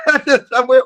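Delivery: breaking into a high laugh mid-sentence; real laughter. Real, not acted. Preacher catches own mistake while reading, laughing. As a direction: crack up over your own slip.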